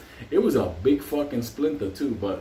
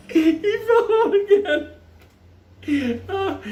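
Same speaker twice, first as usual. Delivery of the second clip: real squealing laughter; cracking up. Real, not acted. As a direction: burst out laughing on it. Laughing at second fainting.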